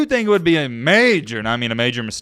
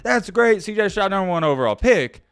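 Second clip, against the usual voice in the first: mock fan enthusiasm; 7 semitones higher, 3 dB louder than usual. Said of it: "Everybody's gonna say".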